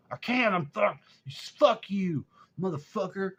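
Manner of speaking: high, loud retold cursing; acted-out helpless, furious swearing; angry cussing. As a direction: imitate a stuck man snapping back in curses. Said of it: "he, he was cussing".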